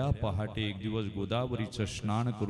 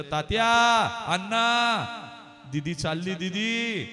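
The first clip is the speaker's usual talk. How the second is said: high loud call across the house; urgent calling out; 11 semitones higher, 6 dB louder, slower than usual. Acted. Someone calling the father to come out, urgent loud call. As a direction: shout for him, the daughter is leaving.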